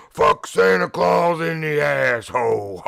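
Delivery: loud, high, slow shout; mock shout. Friend shouting at Santa line, loud slow yell. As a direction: yell it across the mall crowd. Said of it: "this dude's like".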